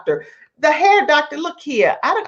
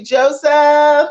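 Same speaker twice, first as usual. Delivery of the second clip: louder, higher sign-off call; calling out goodbye; 7 semitones higher, 5 dB louder than usual. Real, not acted. Host signs off to her guest, calling out. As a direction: sing out the name, pitched up.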